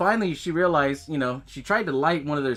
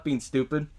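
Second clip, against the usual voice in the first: ordinary talk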